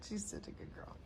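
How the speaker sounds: soft laughing whisper over the dog; whispered praise. Real, not acted. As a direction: breathe the praise with a little laugh.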